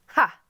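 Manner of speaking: a single loud, high, mocking ha; scornful laugh. A narrator's reading. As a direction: bark out one scornful laugh. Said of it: "I let out a scornful laugh".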